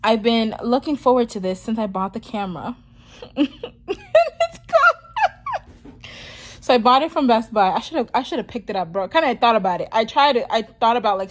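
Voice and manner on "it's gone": loud, very high squeal through laughter; laughing despite upset. Real, not acted. Telling viewers the new camera is gone, laughing despite upset. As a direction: squeeze the words out through a laughing fit. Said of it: "I don't know why I'm laughing".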